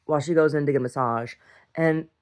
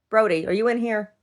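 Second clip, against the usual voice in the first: raised, high call to a pet; calling the dog; 7 semitones higher, 4 dB louder than usual. Real, not acted. Owner checking if Brody is inside, high call. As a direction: call out higher to find him.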